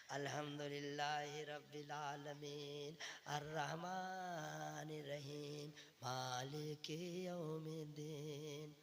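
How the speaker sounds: slow, soft, mumbled recitation; how you recite. Retold or imitated. Preacher mimics the congregation's sloppy recitation. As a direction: lazily drawl it like an untrained reader. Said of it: "आप कैसे पढ़ते हैं?".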